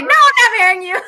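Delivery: high, loud mock refusal through laughter; laughing refusal. Teasing her sister's imagined answer, loud and laughing. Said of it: "You would have been like".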